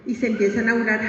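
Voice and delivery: ordinary delivery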